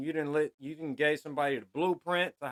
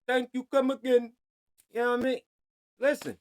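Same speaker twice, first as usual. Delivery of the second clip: high, louder mock Indian shopkeeper accent; 8 semitones higher, 5 dB louder than usual. Mocking a bodega boy in a mock Indian accent. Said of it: "an Indian accent".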